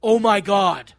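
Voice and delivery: loud, drawn-out exclamation; mock scream. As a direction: cry out in horror, half-screamed. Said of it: "and I scream".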